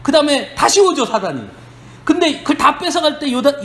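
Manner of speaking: ordinary delivery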